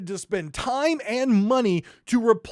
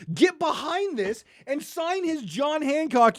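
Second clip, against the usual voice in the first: usual speaking voice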